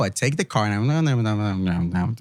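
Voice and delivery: very slow, drawn-out words; mock slow explanation. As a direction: stretch each word like explaining to a child. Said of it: "I'll say it slow".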